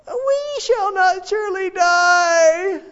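very high, slow shouted echo of the lie; Habakkuk shouting. Retold or imitated. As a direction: shout it up at God. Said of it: "Habakkuk is telling God this".

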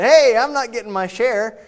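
loud, high-pitched complaint; indignant protest. Acted. Younger brother protests to older brother about his share, indignant. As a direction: protest loudly at being short-changed.

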